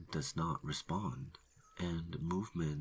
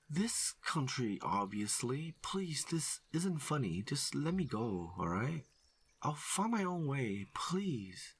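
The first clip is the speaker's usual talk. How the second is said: high, desperate begging; panicked pleading; 11 semitones higher than usual. Acted. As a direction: hiker begging the ranger to let him go. Stranger begging at gunpoint.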